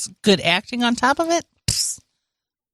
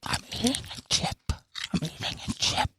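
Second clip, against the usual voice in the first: breathy whisper into the mic; ASMR whisper; 3 semitones higher, 11 dB quieter, faster than usual. Acted. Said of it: "This is A S M R".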